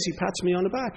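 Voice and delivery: normal talking voice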